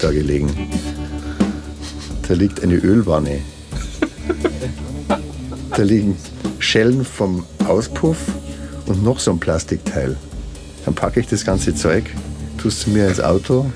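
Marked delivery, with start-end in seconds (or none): none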